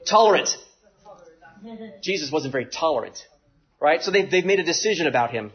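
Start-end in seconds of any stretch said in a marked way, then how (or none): 0.1-0.5 sudden loud, high blurt of the word; word finally found. Real, not acted. Preacher recalling the lost word, sudden loud blurt. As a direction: pop it out as it comes to you. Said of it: "what's the word we like to use".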